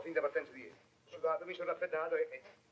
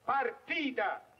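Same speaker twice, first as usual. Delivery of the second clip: Partita dragged out very slowly, each syllable spelt out; father hammering the word Partita; stress on partita; 4 semitones higher, much slower than usual. Acted. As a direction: spell Partita out slowly to the suitor. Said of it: "Col P, come Padova".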